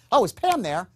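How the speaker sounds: lively, energetic phone opener; enthusiastic greeting. Shown as a demonstration. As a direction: sound genuinely glad to call. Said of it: "if I say".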